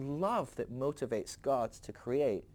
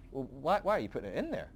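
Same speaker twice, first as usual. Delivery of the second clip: ordinary talk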